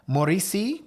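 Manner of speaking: hammed-up French Mauricie, loud and high; mock French accent. Hamming up French place names for viewers, in a French accent. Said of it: "I don't know why I said it like that".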